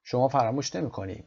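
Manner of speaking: ordinary talk, nothing marked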